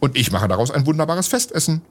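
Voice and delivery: an eager cook boasting of her mushrooms; delighted cook. Acted. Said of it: "freute sich die Köchin".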